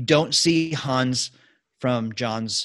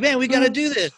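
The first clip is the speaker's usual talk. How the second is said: excited; 11 semitones higher, 3 dB louder, faster than usual. Retold or imitated. Filmmakers thrilled after hearing accent.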